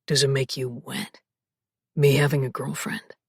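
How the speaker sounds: low, husky male voice, whispered; male love interest voice. Acted. Male lead teasing.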